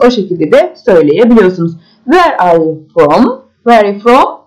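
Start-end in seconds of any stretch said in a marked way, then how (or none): 2.0-3.4 slow, word-by-word question with rising end; slow model question. Shown as a demonstration. Teacher modelling the question for learners, slowly. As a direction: draw each word out for learners to follow.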